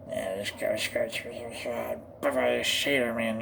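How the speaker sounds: low, soft sailor mumble; garbled cartoon-sailor muttering; Popeye. Trying a Popeye voice, muttering cartoon sailor. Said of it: "I haven't done a Popeye one yet".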